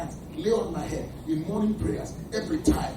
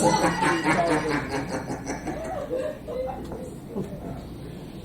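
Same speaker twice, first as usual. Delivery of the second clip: real laugh. Real, not acted. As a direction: chuckle at the joke. Laughs after joke.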